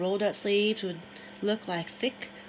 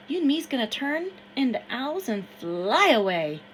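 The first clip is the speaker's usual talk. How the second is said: put-on higher, louder voice of an excited boy in the river; excited Harrison; 5 semitones higher, 6 dB louder than usual. Harrison urges Samuel into the river, higher and louder. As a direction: urge him in, wild and eager.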